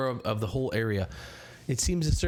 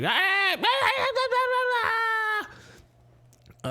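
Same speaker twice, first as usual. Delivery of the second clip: shrill high goblin cackling, much higher; goblin cackle; slower than usual. Acted. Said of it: "this sort of voice like".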